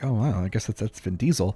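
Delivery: low, quiet, imagined shrug of a reaction; underwhelmed. Pretend. Fan shrugging at spotting Vin Diesel in public, underwhelmed. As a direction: play it mildly unimpressed, low and soft.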